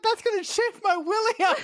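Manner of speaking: high, whiny blubbering sheriff; mock crying sheriff. Friend voicing the sheriff whining in despair. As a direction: wail it like a desperate villain.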